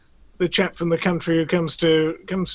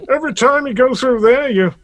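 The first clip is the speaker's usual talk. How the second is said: higher, louder punchline in a put-on American voice; amateur impression, delivering the punchline higher and louder; Thomas Edison; 7 semitones higher, 6 dB louder than usual. Inventor explains his turnstile to visitors, amateur impersonation. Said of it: "impersonation of either Edison".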